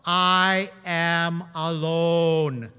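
very slow, deliberate I am alone; slow, overarticulated complaint; overenunciated. Retold or imitated. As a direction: spell out each word of I am alone. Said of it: "Watch my mouth".

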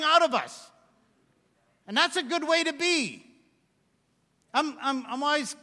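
high, loud pulpit voice; fervent sermon climax; impassioned preaching. Acted. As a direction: preach joy pouring out. Impassioned preaching about joy.

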